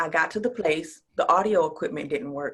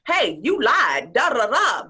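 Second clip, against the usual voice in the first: slow, loud mock shout; mock accusation; 7 semitones higher, 9 dB louder, slower than usual. What she would have snapped at the event host, slow mock shout. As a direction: bark the accusation slowly, half playing. Imagined angry reaction.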